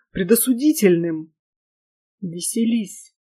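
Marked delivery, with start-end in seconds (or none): 2.2-3.2 soft, held-back reply; restrained. A narrator's reading. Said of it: "сдержанно сказал он".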